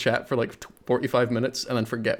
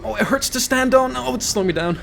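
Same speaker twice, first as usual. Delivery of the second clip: higher mock-pained cry; hammed-up whine; 11 semitones higher, 4 dB louder than usual. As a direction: overact the pain. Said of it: "every other YouTuber".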